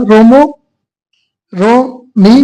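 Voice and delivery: ordinary talk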